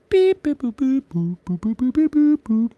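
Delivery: string of high-pitched robot boops; high playful beeping. Pretend. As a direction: beep and boop up high. High nonsense beeping while drawing.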